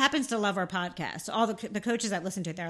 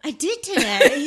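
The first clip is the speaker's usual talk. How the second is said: said through a big laugh; laughing, slow and loud; laughing admission; 5 semitones higher, 9 dB louder than usual. Real, not acted. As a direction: laugh it out, caught out. Admits listening to coach.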